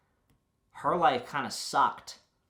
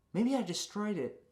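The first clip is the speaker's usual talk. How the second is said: wistful high take; 9 semitones higher than usual. Shown as a demonstration. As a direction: lift it up, musing and dreamy.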